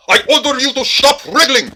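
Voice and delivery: high, loud, strained command; shouted order. Acted. Officer holding a squirming suspect, shouting. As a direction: shout while wrestling someone.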